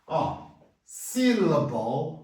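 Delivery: syllable drawn out slowly, SIL-a-bol with a schwa; slow teaching pronunciation. Shown as a demonstration. Teacher slowly models syllable for Portuguese learners. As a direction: spell syllable out slowly for students. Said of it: "Vejamos aqui agora".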